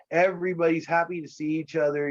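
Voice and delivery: ordinary talk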